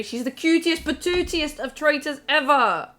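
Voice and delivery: high, loud and gushing. Real, not acted. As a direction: gush over her, slowly. Gushing about Mina.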